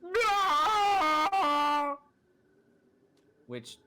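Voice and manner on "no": very high, loud bleating shout; loud sheep cry. Acted. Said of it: "you hear a loud".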